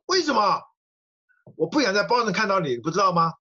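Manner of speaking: loud scornful retort; retold angry retort. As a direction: snap back at her like a bully.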